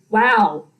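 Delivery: ordinary delivery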